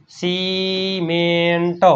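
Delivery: Cement very slowly, each syllable stretched; drawn-out sounding-out. Shown as a demonstration. Teacher repeats cement for students, very slowly.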